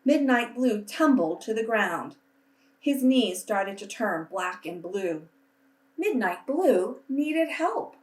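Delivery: put-on reading a picture book aloud to children, unhurried; storytelling voice. A narrator's reading. Teacher reading to her class, gentle storytelling voice. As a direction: paint each colourful list for young listeners.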